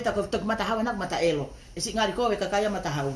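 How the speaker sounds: ordinary delivery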